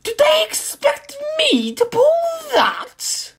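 very high outraged diesel lady; appalled that they dare ask; indignant. A narrator's reading. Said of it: "she asked indignantly".

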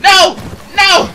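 high panicked screams; panicking gamer. Real, not acted. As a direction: scream no in terror. Next to be killed.

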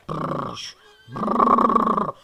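horse-calming brrr. Real, not acted.

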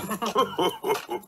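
soft breathy chuckle; little amused laugh. A narrator's reading. Daddy Pig laughing.

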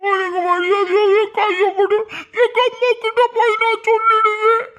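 high, whiny mimicry; hammed-up wail in a put-on high voice; mocking her crying. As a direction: parrot her tearful threat in mockery. Mimics her crying threat to mock her.

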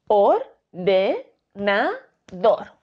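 or, de, na, dor split apart; chopped into four distinct syllables. Shown as a demonstration. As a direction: say each syllable separately. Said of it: "Si dividimos las sílabas".

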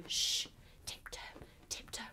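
very soft tiptoe whisper; hushed whisper. A narrator's reading. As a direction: whisper secretly as if creeping into a cave. Tiptoeing into the cave.

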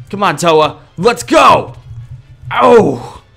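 normal talking voice

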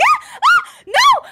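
grito agudo e alto de susto; grito chocado. Acted. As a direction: solte um não desesperado. Reação chocada de S2. (grito de susto bem agudo)

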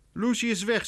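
high, indignant statement; angry mother. A narrator's reading. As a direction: announce it crossly. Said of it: "zegt Laura boos".

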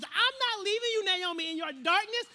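determined. Acted. Ruth vowing to stay with Naomi, determined and emphatic. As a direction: pledge it firmly, stressing each promise. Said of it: "the same type of determination that Ruth was".

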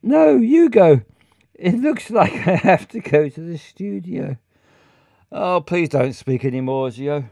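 ordinary delivery

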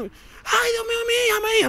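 very high-pitched wail of despair; acted, shrieky lament; imitated crying mother. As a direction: mimic a mother wailing for her lost child. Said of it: "La mujer está llorando".

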